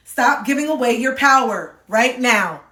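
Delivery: first forceful take of the message; emphatic command. Acted. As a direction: deliver it as a firm wake-up call. Delivering the card's message.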